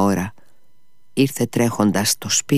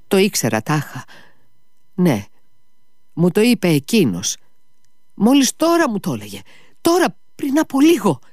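feverish rising rave; 10 semitones higher than usual. A narrator's reading. Ivan raving to his brother about the visitor, feverish rising voice. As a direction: let the delirium build up and up.

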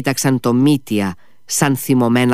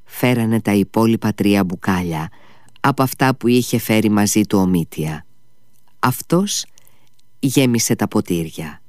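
ordinary delivery

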